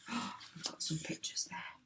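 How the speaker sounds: soft whispered aside. Real, not acted. Aside to listening children.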